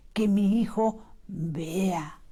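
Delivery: slow, whispered prayer; heartfelt whisper. Shown as a demonstration. Parent praying for a child's sight, whispered. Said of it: "pero con el corazón".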